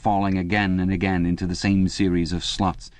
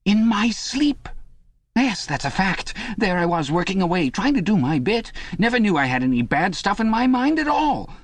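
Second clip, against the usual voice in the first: much higher, anxious confiding voice; confiding Parsons; 9 semitones higher than usual. A narrator's reading. Parsons telling Winston his story, high and nervous. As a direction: pour out the story in a nervous rush.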